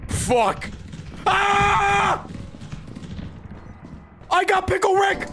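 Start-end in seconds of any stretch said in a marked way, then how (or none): 1.3-5.3 startled high shout; yelp, then call the guys; screaming at a spider. Real, not acted. Ambushed by a spider in game.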